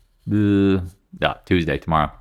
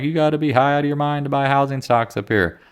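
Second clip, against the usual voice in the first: quoting himself; 7 semitones higher than usual. Retold or imitated. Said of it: "said this, like".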